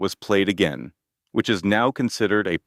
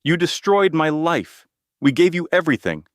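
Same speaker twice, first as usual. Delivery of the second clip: desperate scream; 9 semitones higher, 3 dB louder than usual. A narrator's reading. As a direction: scream it while being held down. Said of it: "he screamed".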